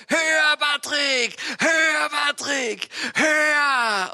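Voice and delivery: high, loud cheering call; angels cheering. Retold or imitated. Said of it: "ganz viele Engel sind herum, gell, und sagen".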